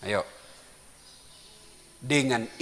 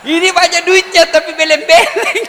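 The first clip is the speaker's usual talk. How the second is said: high, loud with laughter; loud laughing beleng-beleng; laughing mockery; 14 dB louder than usual. Real, not acted. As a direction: deliver the punchline cracking up. Mocking punchline.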